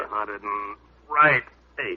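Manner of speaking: slow, effortful counting between reps; straining mid push-up. Acted. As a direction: grunt out the count slowly. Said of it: "Seymour did his push-ups".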